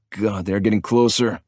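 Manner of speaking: ordinary delivery, nothing marked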